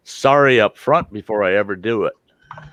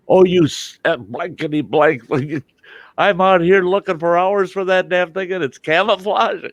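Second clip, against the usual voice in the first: angry exasperated friend; 10 semitones higher than usual. Retold or imitated. Angry friend yelling over lost camo.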